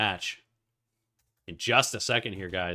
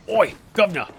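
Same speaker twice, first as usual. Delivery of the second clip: high, loud mock British hail; British accent; 9 semitones higher, 9 dB louder than usual. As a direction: call out like a cartoon Brit. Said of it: "to give you a British cast".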